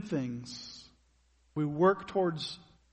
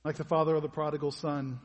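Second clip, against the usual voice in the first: prayer; 3 semitones lower than usual. Acted. Leads closing prayer.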